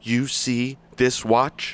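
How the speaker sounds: slowed deliberate question; slow menacing repeat. Acted. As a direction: drag out each word to intimidate.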